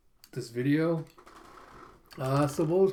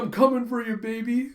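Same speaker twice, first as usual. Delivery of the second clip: higher, playfully eager call; mock eager cry; 9 semitones higher, 5 dB louder than usual. Lovestruck man vows to win his crush, eager. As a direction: call out to a sweetheart, pitched up.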